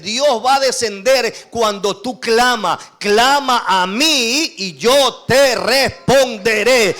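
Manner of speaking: slow, loud preaching cry; full-force preaching. Acted. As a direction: drive every word home to the church. (predicación a todo pulmón, lenta y fuerte)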